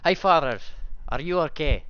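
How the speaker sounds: put-on high, loud accented voice of a devoted follower; accented, high-pitched worried greeting; Shen's character accent. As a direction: call out to father in high character voice. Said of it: "Let me get the right one".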